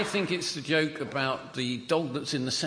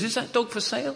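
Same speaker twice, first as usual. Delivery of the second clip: higher, keen asking voice; a lightly voiced eager bloke; eager customer; 11 semitones higher than usual. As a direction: ask like you can't wait to buy.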